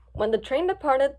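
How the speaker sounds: ordinary delivery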